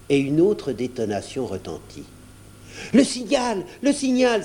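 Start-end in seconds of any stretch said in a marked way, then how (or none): 2.9-4.4 high-pitched, thrilled shout; excited cry. A narrator's reading. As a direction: cry out the news twice, high and eager. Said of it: "s'écria Sajo".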